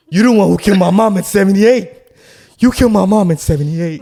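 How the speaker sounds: high, loud, still in character; high accusing yell. Retold or imitated. Unhinged man yelling at the visitor, high and loud. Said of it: "I wanna stay in character".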